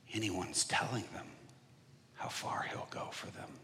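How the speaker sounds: soft whispered quote; breathy, fading whisper. Retold or imitated. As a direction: hush it urgently.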